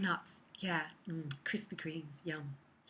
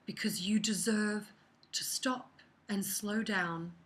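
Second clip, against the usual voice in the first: ordinary talk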